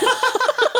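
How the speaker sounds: high-pitched giggling fit; high giggle. Real, not acted. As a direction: burst into giggles. Laughing at own mistake.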